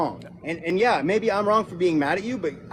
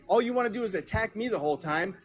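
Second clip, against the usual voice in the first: ordinary talk, nothing marked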